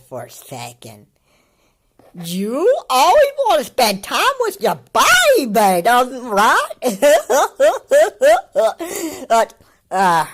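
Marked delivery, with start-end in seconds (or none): 2.1-6.7 high-pitched, loud teasing coo; mock him in an exaggerated high voice; mocking baby talk. Reactor imitating the kid cooing over his baby, mocking baby talk. 6.7-9.2 long, high, loud giggle; giggling laugh. Real, not acted. Reactor tickled by mocking the kid, high giggling laugh. Cracks up at own mockery.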